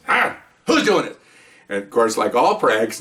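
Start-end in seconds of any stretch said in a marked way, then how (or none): 0.1-1.2 indignant demand. Retold or imitated. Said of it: "he says".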